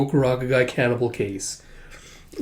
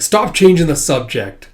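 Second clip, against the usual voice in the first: loud, higher-pitched demand; angry outburst; 4 semitones higher, 6 dB louder than usual. Acted. Scarred man snapping his chopsticks, angry outburst. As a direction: cut them off angrily.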